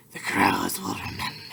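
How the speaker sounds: creepy whisper. Pretend. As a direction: menace your roommate in a low whisper. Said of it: "I looked at her and I was like".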